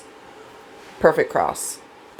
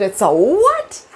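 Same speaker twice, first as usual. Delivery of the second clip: alarmed; 6 semitones higher, 4 dB louder, slower than usual. Retold or imitated. Learns she's petting a shark.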